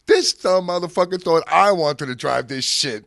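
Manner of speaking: loud, high gleeful ridicule; imitated mocking holler. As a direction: crow about a friend's silly mistake. Pun mocks him to his mom.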